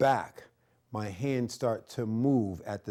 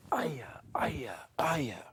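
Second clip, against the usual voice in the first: breathy whispered karate shouts; an instructor marking strikes in a whisper; whispered kiai; 3 dB quieter, much slower than usual. Shown as a demonstration. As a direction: hiss the kiai under your breath. Whispered kiai during form.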